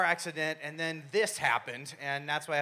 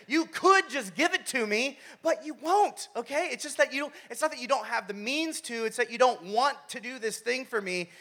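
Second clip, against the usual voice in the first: put-on high, insistent teen complaint; mock frustration; 6 semitones higher than usual. Teen silently arguing with dad, mock frustration. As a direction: argue it in a raised, aggrieved kid voice. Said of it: "it just seems so frustrating".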